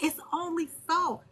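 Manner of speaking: ordinary talk, nothing marked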